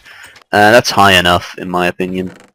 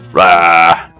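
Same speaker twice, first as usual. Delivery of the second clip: mock roar; 4 semitones lower than usual. Playful roar.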